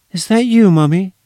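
put-on high, small child's voice, a little anxious; small curious child. A narrator's reading. Little girl on the phone asking for her mummy, high and curious. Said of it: "tiny, slightly curious and anxious voice of a child".

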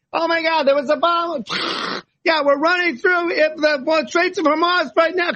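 high-pitched, shouted mock field report; hammed-up war-zone dispatch, voice way up; frantic war reporter. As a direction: play a panicked correspondent under fire. Acts out war-zone journalist reporting live.

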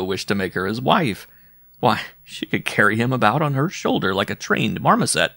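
plain reading voice

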